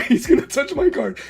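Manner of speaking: half-laughing dread for his card; laughing mock panic. Retelling his fear at locals, laughing mock panic. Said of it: "And he's like".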